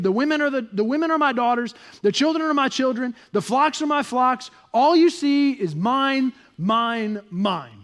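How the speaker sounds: loud, high, possessive insistence; acted toddler-like protest, starting high and stepping down; Laban as toddler. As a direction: claim everything, stretching out the last words. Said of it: "sounds a little bit like a toddler".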